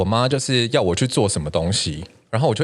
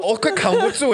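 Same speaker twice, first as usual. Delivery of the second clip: higher voice breaking into laughter; laughing, can't hold it. Real, not acted. Telling the photo story while cracking up, laughing. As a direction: squeeze the words out while cracking up.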